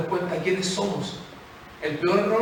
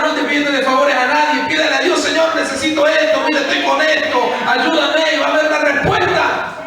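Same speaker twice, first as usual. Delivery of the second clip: preaching at full force; 9 semitones higher, 7 dB louder than usual. Acted. Preacher exhorting the congregation to pray, fiery and loud. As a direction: urge them to cry out to God.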